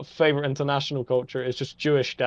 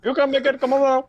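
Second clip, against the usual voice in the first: high, louder mock Indian accent; 10 semitones higher, 4 dB louder than usual. Cohost joins the pedestrian joke, mock Indian accent. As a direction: echo the coaxing in a put-on Indian accent. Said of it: "joining the mock quote".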